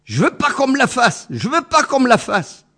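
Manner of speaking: high, loud bellow of refusal; bellowing gorilla. Acted. Gorilla bellows at the doctor, refusing the injection, loud bellow. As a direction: roar it out, refusing the shot. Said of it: "Bref, beuglait".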